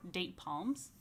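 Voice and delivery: ordinary delivery, nothing marked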